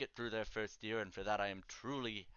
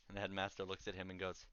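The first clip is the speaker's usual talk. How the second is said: ordinary talk, nothing marked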